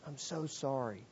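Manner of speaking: hushed sympathy. Retold or imitated. Preacher to injured Kevin at the bedside, hushed sympathy. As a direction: say it gently under your breath. Retold visit at hospital bedside.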